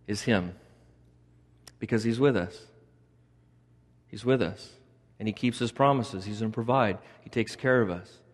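soft, low, intimate comfort; quiet pastoral reassurance. Real, not acted. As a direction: drop down and reassure them gently. Preacher turns intimate, reassuring.

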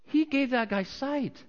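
high, slow, emphatic amazement; astonished onlooker. Acted. Onlooker marvels at the healing.

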